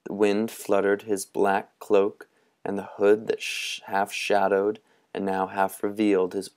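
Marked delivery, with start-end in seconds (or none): none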